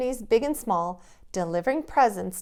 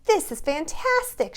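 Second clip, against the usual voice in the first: excited shout; 7 semitones higher, 4 dB louder than usual. Acted. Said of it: "shouted Katie".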